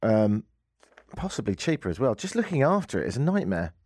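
usual speaking voice

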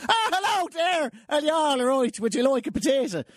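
teasing his Irish cousin on the phone in a mock Irish accent. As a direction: ham up a cartoon Irish accent to tease. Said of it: "I used to go".

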